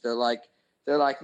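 ordinary talk

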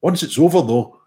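usual speaking voice

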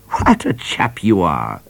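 slow, odd trooper voice with admiration; admiring. A narrator's reading. Trooper praising Midnight, admiring. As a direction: say it fondly. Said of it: "he said admiringly".

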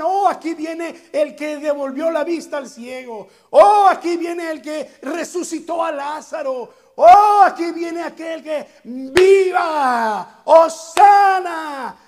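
building from eager to a loud, high, slow shout; swelling cry of Hosana; excited crowd. Acted. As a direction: hail the miracle worker as he arrives. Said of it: "muchos decían".

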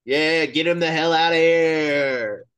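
loud, high-pitched cheer; celebratory shout. Real, not acted. Co-host cheering a player's DFA, celebratory shout. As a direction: holler it like a gleeful fan.

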